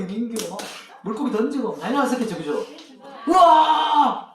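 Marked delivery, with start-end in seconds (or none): 3.3-4.3 a long drawn-out, louder, higher whoop of delight; mock shout of a kid thrilled by the catch; hammed-up cheer at a net full of fish; excited yell. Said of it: "이렇게 막 소리 지르면서".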